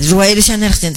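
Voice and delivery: ordinary delivery, nothing marked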